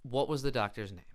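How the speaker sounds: ordinary delivery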